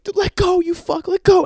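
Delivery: high, loud frantic command; acted yelling. Owner grabbing the dog, acted frantic yelling. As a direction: yell at a dog that won't release.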